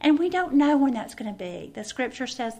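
ordinary talk

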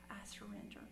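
breathy whisper of giving in; hushed whisper of surrender to God. Retold or imitated. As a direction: barely voice it, letting go. Kneeling prayer of surrender.

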